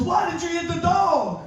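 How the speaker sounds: mock angry wife. Wife yelling after slap.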